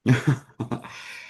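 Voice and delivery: short real laugh before answering; real, wordless chuckle; amused laugh. Real, not acted. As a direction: let out a brief chuckle. Amused before answering about early days.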